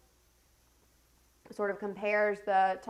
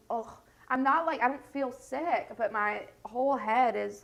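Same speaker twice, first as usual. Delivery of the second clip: ordinary talk, nothing marked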